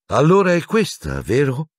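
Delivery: high brisk stern teacher, no preamble; strict professor getting straight to it. A narrator's reading. McGonagall confronting Harry about the broom, brisk. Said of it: "senza preamboli".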